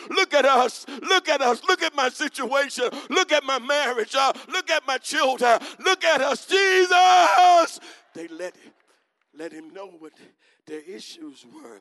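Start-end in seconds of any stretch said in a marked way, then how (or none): 0.1-7.8 high, loud begging cry; desperate pleading. Acted. Lepers begging Jesus to notice them, loud pleading. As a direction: wave your arms and beg to be seen. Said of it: "They made a loud noise".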